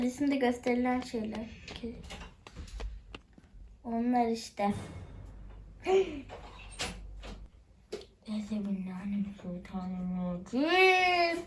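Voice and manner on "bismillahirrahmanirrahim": slow, breathless whispered bismillah; scared gamer whispers a quick prayer. Real, not acted. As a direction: murmur the prayer for protection.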